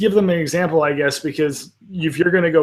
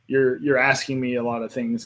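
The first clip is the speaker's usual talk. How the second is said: usual speaking voice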